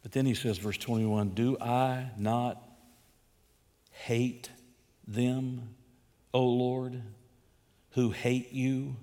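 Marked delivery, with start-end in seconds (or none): 1.3-9.0 "hate" drawn out slow and hushed, with long pauses; slow and quiet. Shown as a demonstration. Preacher reading David's question slowly and softly. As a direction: weigh each word slowly. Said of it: "he's asking it as a question".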